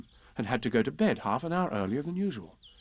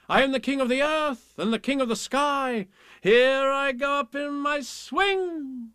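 higher, slow sing-song recitation; poem reading; 13 semitones higher, 4 dB louder, slower than usual. A narrator's reading. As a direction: rock the lines like a swing. Reads a swing poem.

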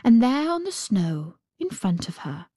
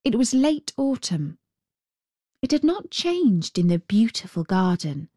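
usual speaking voice

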